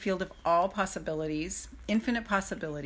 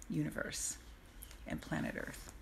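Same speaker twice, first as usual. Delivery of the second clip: soft trailing; 4 semitones lower, 14 dB quieter than usual. Real, not acted. Trailing dreamy list.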